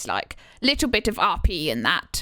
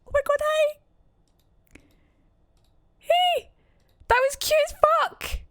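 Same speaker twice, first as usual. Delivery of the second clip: very high, excited squealing; delighted squeal. Real, not acted. Streamer thrilled by a personal message, delighted squeal.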